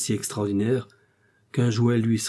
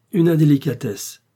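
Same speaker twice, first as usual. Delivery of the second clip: bitter smile; 5 semitones higher, 7 dB louder than usual. Acted. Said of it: "en souriant avec un peu d'amertume".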